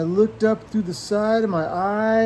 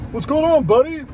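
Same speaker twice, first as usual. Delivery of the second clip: friendly greeting; 9 semitones higher, 5 dB louder than usual. Acted. Greeting the owl.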